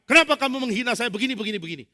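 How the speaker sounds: loud, fast, high angry accusation. Acted. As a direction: snap at him for insulting you. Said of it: "Ada orang marah-marah". (tuduhan marah, keras dan cepat)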